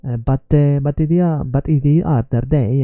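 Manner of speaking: hammed-up Italian accent in English. Shown as a demonstration. Learner making fun of own accent, mock Italian accent. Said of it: "So you start to speak in a, like this".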